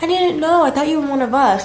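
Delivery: drawn-in "oh", acting out their surprise; surprised realisation.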